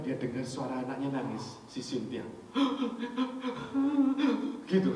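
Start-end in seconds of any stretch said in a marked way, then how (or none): 2.3-4.6 imitated child sobbing gasp. Child crying sound imitated.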